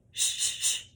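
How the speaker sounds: unvoiced shh pulses; breath-support shh drills; shush. Shown as a demonstration. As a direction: push short hisses from the belly. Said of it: "repeat after me".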